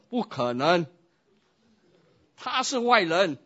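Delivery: loud, high indignant refusal; angry refusal. Acted. Husband to pastor, refusing to love wife, angry. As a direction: refuse outright in outrage.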